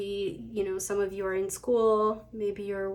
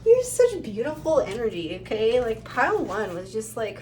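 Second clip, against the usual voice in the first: ordinary talk, nothing marked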